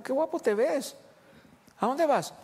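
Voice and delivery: high, admiring wow again; delighted surprise. Retold or imitated. Wife repeats her amazed question to husband. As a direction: sound thrilled and curious.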